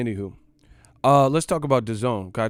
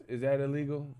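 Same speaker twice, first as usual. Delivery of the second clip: ordinary talk, nothing marked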